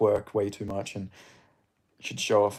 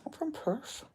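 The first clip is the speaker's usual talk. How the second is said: "I'm from Perth." said much higher and softer; squeaky mock voice; 13 semitones higher, 11 dB quieter, slower than usual. Shown as a demonstration. Streamer tries the Perth line again in a silly high voice. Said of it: "I don't know what accent I was trying to do".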